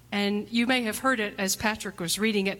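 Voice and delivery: ordinary talk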